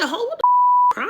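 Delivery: high, loud, slow angry yell. Retold or imitated. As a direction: hurl it at her, furious. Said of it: "So I said, I said".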